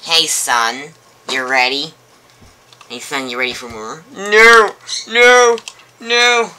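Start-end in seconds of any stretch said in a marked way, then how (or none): 4.1-6.5 high, loud panicked no; terrified screaming. Acted. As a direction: scream it in terror. Charlie refusing in terror.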